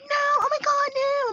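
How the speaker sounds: very high-pitched mock dismay; protesting grandma. Grandma protests the strict parenting, high mock dismay. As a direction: imitate a grandma objecting, way up high. Imitating her mother protesting.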